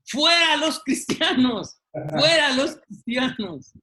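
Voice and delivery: high, loud, insistent shouting; the protester demands they come out; mock protester shouting at the pastor. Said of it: "una de las gentes de la manifestación le dice".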